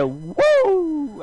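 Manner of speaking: high, far-off wrestler's woo; Ric Flair woo. Retold or imitated. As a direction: let out a big showman's woo. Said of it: "so Ric Flair comes out".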